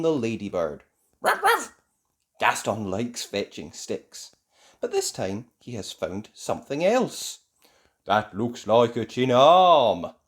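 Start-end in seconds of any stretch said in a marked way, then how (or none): 1.2-1.7 high, loud playful yapping bark; ladybird's bark. A narrator's reading. Gaston the ladybird playing fetch, high barking. Narrator barks as the ladybird pet. 8.1-10.1 loud, slow exclamation; crying out. A narrator's reading. Barnaby spotting what the pet found, crying out. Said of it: "cries Barnaby".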